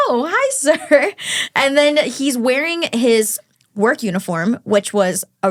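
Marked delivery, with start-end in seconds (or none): 0.1-1.1 mock polite greeting. Greeting a short date.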